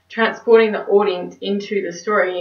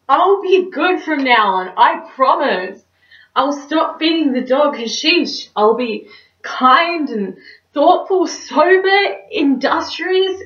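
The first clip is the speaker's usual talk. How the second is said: theatrical begging, higher and louder, then sinking; desperate pleading; 5 semitones higher, 5 dB louder, slower than usual. Acted. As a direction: bargain frantically with God, as on stage. Cynthia pleads with God in monologue.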